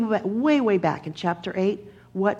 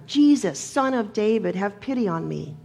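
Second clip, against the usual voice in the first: insistent yell; 3 dB louder than usual. Retold or imitated. Hushed blind beggar to Jesus, yelling louder to be heard. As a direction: yell the name out, then let the plea drop. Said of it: "he yells even louder".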